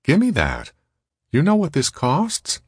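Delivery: high-pitched scolding woman; high character voice, rising into the question; Molly. A narrator's reading. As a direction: pitch it well up, demanding and incredulous. Molly scolds, takes his plate.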